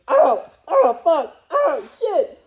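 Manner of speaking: high, loud, laughing cries of pain; mock pain yelps. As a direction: yelp it like it hurts, half laughing. Retelling pain at the strip club.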